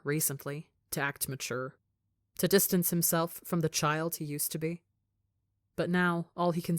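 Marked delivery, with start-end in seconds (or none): none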